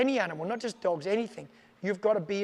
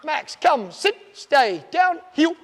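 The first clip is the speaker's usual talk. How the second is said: loud, high barked commands; shouted dog commands; 6 semitones higher, 10 dB louder, much slower than usual. Shown as a demonstration. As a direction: shout each command with strong tone, then praise. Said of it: "There's a lot of tone in that".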